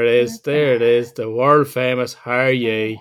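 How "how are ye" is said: lightly mimicked Northern Irish greeting; imitating partner's Northern Irish catchphrase. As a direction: tease with a drawn Northern Irish lilt. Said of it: "I'm saying it's your trademark".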